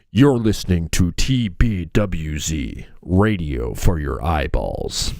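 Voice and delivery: deep station ID read; announcer voice. Shown as a demonstration. Host demoing the station tagline in a low announcer voice. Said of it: "we have to have that tagline in there".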